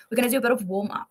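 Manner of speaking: normal talking voice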